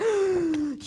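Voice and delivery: sharp mock gasp of alarm; shocked gasp. Townsfolk learning he is from Mantua, shocked gasp. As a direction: gasp in exaggerated horror. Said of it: "and they freak out".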